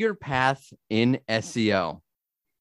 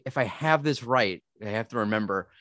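normal talking voice

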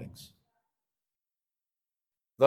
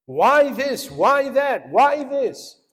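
louder, higher pressing questions; Gideon questioning; 8 semitones higher, 8 dB louder, slower than usual. Retold or imitated. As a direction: fire the questions at the angel. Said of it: "he's asking him all these questions".

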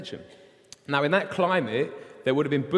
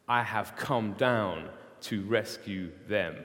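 slow, lower, grave declaration; slow solemn voice of God; 3 semitones lower, slower than usual. Acted. God tells Moses he has seen the suffering, slow and solemn. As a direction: let each promise land slowly and gravely. God's promise, read slowly.

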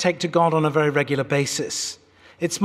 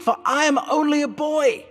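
put-on higher, pleading whine on 'for I am only a boy'; whining boy; 9 semitones higher than usual. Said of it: "stop your whining".

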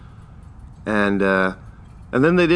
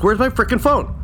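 panicked; 11 semitones higher than usual. Retold or imitated. Panicking over a lost phone.

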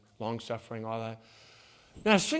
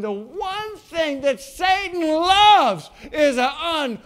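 full-force pulpit shout about Satan; preaching at full force; 8 semitones higher, 9 dB louder than usual. Real, not acted. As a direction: thunder it at the congregation. Peak of the sermon.

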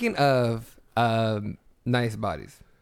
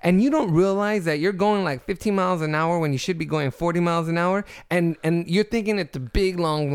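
angry rant; 6 semitones higher, 4 dB louder than usual. Real, not acted. Ranting about slow drivers.

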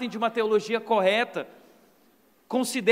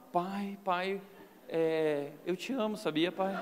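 put-on softer, coaxing little boy voice; butter up dad gently; sweet-talking child; 3 semitones lower, 6 dB quieter, slower than usual. Son sweet-talking his father to avoid punishment, softly. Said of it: "Eu já começava".

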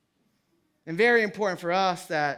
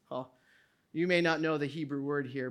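normal talking voice

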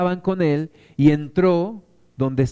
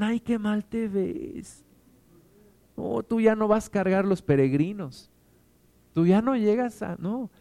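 mock pitying naysayer; 5 dB quieter than usual. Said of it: "no personas que te digan".

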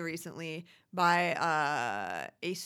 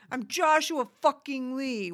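mock outburst; 7 semitones higher, 7 dB louder than usual. Imitating London's outburst.

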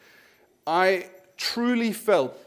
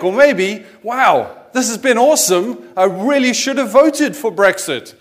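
louder and higher, hamming up the other side's delight; mock enthusiasm; 5 semitones higher, 8 dB louder than usual. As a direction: play it like a gushing convert, tongue in cheek. Mock view of Brexit supporters.

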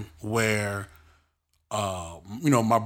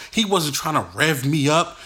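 ordinary talk

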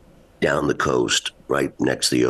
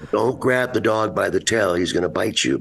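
higher-pitched acted dad; mild mock parental scolding; warning parent; 7 semitones higher than usual. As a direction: warn a little kid off. Acts himself warning his child.

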